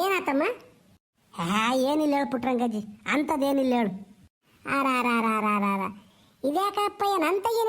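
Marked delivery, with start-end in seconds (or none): 1.3-4.1 low voice. Acted. Low-voiced figure answering grandma. 4.8-5.9 low drawn-out wailing ara ara. Acted. Old woman wailing syllables.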